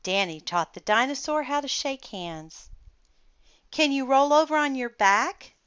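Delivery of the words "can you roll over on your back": put-on high, louder child voice; bright eager question from a kid; children asking. A narrator's reading. Children ask the dinosaur, high and loud. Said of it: "asked the children".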